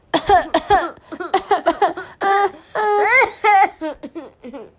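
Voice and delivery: long, loud, high coughing fit; hammed-up coughing and gasping from smoke; exaggerated choking cough. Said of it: "呛得她喘不过气". (夸张地呛咳、喘不过气)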